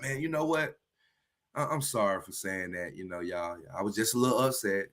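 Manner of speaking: very soft, low, backing-down apology; hushed, contrite climb-down after anger; sheepish apology. Acted. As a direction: drop right down and apologize meekly. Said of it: "raise my tone or be angry".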